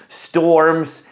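ordinary talk, nothing marked

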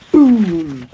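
loud, drawn-out boom; booming sound effect. A narrator's reading. Narrator voices the monster's step, booming. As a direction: boom it out like a giant footfall.

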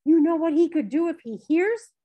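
high, loud, alarmed question; urgent warning. A narrator's reading. Mother fears the master will hear her son, urgent warning. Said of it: "she warned, looking toward the house".

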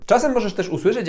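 ordinary delivery

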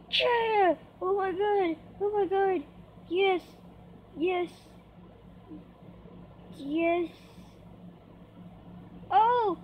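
high, thrilled cheering; triumphant. Real, not acted. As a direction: celebrate a narrow win in real excitement. Celebrates making the jumps.

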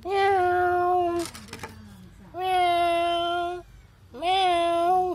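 high drawn-out meow, imitating a cat; mock cat meow. Vlogger calling the stray cat with a mock meow. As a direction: meow high and long like a kitten.

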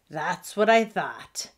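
ordinary delivery, nothing marked

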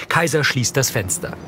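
normal talking voice

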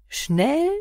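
mocking high rising echo; scornful. A narrator's reading. Driver mocking his passenger's complaint, scornful echo. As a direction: scoff at the word. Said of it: "höhnte Antonio".

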